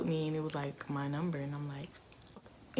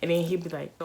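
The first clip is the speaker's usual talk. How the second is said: normal talking voice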